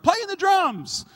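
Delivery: put-on high, loud, slow shout of a kid; kid yelling out. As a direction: yell it from the back like an eager kid. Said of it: "yelled out".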